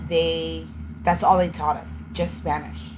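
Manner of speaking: usual speaking voice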